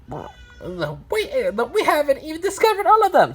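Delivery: high, quick character voice; reply high-pitched and hurried; number block character. Acted. Two answers in character.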